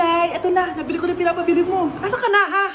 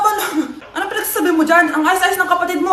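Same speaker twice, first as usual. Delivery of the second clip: usual speaking voice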